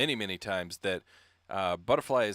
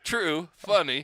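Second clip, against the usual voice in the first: put-on louder, slow kid voice being cheeky; 5 semitones higher, 6 dB louder, slower than usual. Daughter cheekily telling mom the word is funny, loud. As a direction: play the kid who thinks a rude word is hilarious.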